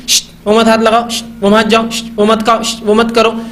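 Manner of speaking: sharp shush before each short prohibition; imitated shushing. Parent stopping a child touching things, imitated shushing. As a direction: keep hushing, then forbid, in a repeated pattern.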